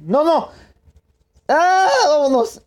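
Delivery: high, loud frantic yelling; panicked shriek. Real, not acted. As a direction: scream in sudden panic. Dying panic in game.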